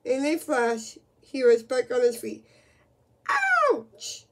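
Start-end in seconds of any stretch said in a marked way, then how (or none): none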